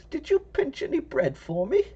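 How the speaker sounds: put-on high, creaky old woman voice; little old lady voice. Old lady asks for bread.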